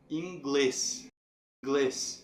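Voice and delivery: "Inglês" with weight on final "glês"; stress on glês. Shown as a demonstration. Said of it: "sendo a forte". ("Inglês" com "glês" forte)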